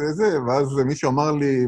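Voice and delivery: ordinary talk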